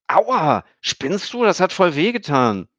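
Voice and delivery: high, loud pained outcry; ouch. A narrator's reading. Stefan gets poked in the belly, loud pained yelp. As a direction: yelp after a jab.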